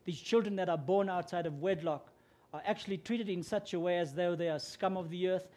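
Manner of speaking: usual speaking voice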